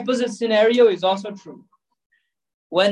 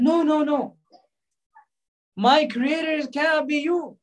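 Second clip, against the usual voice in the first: defiant newborn; 7 semitones higher than usual. Retold or imitated. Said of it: "He said".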